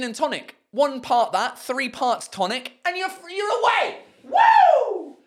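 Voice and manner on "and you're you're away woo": high, loud whoop; whooping cheer, much higher; celebration. Real, not acted. Celebrating the drink.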